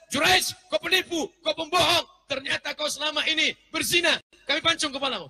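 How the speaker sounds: high shouted accusation; angry crowd. Acted. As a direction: villagers furiously accuse Juraij. Villagers accuse Juraij. (warga menuduh dengan marah dan berteriak)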